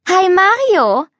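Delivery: high, loud cheerful hello; beaming with delight; bright greeting. Acted. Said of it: "Sie strahlte ihn an".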